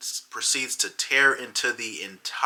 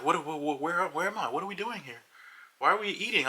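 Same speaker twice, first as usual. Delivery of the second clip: much higher, bewildered and rattled; confused; 8 semitones higher than usual. Retold or imitated. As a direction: wake up lost, asking in a rush. Girlfriend suddenly sobering up, confused.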